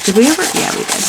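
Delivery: usual speaking voice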